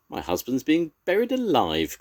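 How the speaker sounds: mother rabbit panicking for her husband; panicked cry. A narrator's reading. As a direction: cry out in alarm. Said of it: "cried Mother Rabbit in a panic".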